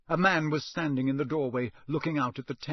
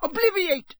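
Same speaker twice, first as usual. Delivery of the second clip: much higher and louder, a sharp incantation; sharp spell; 6 dB louder than usual. Acted. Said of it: "he said sharply".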